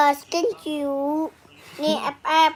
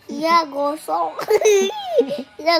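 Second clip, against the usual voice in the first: ordinary talk, nothing marked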